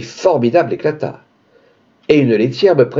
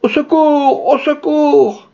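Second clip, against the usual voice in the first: much higher, frantic cry for help; 14 semitones higher, slower than usual. Acted. Panicked man pounding the wall, shrieking high for help. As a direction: yell it high and desperate. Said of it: "en vociférant".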